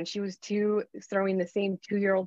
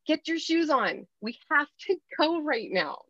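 put-on brisk, sharp order to the kids, a little higher; 7 semitones higher than usual. Shown as a demonstration. Mother to kids at the door, sharp tone. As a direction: snap them to attention without yelling. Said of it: "sharp tone to your kids".

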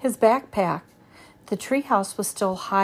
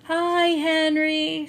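shout; 7 semitones higher, much slower than usual. A narrator's reading. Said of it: "shouted Annie".

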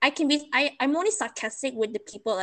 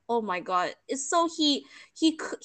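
ordinary talk

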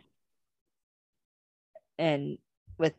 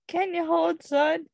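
put-on much higher, louder good old boy drawl; mock the good old boy cop's accent, pitched way up; 14 semitones higher, 3 dB louder, slower than usual.

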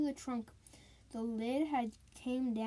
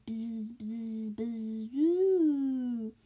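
buzzing wind sound, last one louder; howling wind noise; 6 dB louder, much slower than usual. A narrator's reading. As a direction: imitate the wind rattling the house. Wind noise in ghost story.

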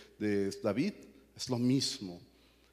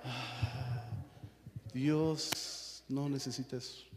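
mock pious; 4 dB quieter, much slower than usual. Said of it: "ponemos nuestra carita de angelicales".

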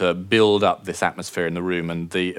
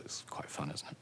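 whispered aside; 4 semitones lower, 20 dB quieter than usual. Real, not acted. Aside after describing wine buyers.

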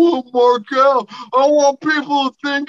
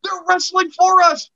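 excited; 7 semitones higher than usual. Real, not acted. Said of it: "be excited".

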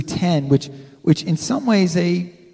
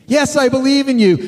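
higher, louder eager reply; eager hiker; 7 semitones higher, 5 dB louder than usual. Retold or imitated. Hiker eagerly assures God, high and loud. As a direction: answer fast and keen. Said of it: "the voice says".

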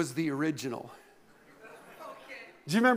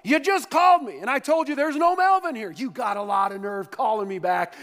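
loud, high furious shout; heated reply; 6 semitones higher, 5 dB louder than usual. Acted. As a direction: yell it down the phone. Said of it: "came a heated reply".

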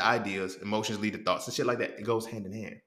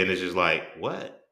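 ordinary talk, nothing marked

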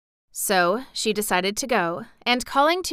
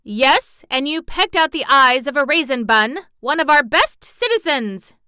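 loud, higher accusing shout; 6 semitones higher, 8 dB louder than usual. Acted. A bread pudding shouts at the hen, loud and accusing. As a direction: shake your fist and yell the charge. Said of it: "shouted a bread pudding".

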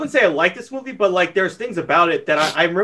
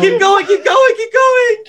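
pleading shout, much higher and louder; excited shout; 4 dB louder, slower than usual. Real, not acted. Urging the spinning wheel past Kroll, excited shouting. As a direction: yell at the wheel to keep spinning.

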